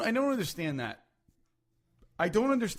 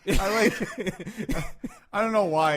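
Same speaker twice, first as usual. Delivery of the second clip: normal talking voice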